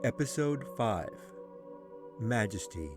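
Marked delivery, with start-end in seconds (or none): none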